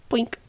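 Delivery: high, short "poink"; plucking sound effect. A narrator's reading. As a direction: imitate a hair popping out. (hoog plukgeluidje)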